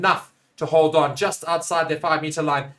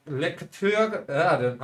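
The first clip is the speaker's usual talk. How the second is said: normal talking voice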